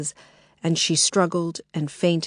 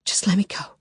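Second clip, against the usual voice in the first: Lily pleading with the intruder under her breath; whispered plea. Acted. Hushed plea. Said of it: "Lily pleaded".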